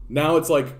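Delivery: ordinary delivery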